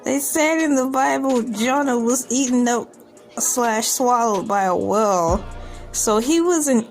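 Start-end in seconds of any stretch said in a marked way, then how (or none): none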